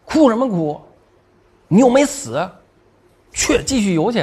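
high-pitched angry mom scolding; retold scolding in short, high bursts; exasperated mom. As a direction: imitate a mother snapping at her crying kid, pitch jumping up. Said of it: "然后我妈就急了".

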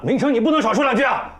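high, loud rebuke; exploding at a younger brother; angry shout. Acted. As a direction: yell at him to shut up. Brother cuts off the tirade.

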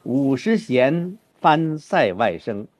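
slow, balanced reading; slow matched model line; parallel phrasing. Shown as a demonstration. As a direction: mirror the previous line's pauses. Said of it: "有个对仗的句子".

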